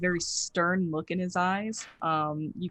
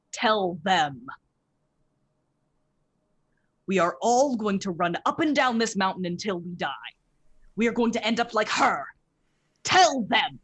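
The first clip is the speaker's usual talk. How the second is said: loud, high protesting shout; angry outburst; 4 semitones higher, 6 dB louder than usual. Acted. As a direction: yell it in protest, pointing at him. Mustard protests, throws down pack.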